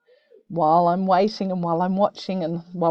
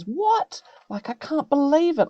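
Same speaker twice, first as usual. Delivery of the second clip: shocked disbelief, pitched up; 8 semitones higher than usual. Shown as a demonstration. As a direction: act out real shock at the news. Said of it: "that's definitely shocked".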